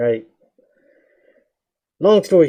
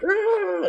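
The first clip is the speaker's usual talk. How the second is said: rage yell; 3 dB quieter than usual. Retold or imitated. Rage yell during fight.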